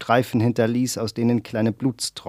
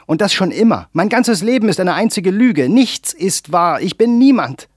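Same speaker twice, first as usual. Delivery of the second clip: rising, much higher and louder despairing shout; screaming in anger; 9 semitones higher, 6 dB louder than usual. A narrator's reading. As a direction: break into a full scream by the end. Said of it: "Er schrie, schluchzte".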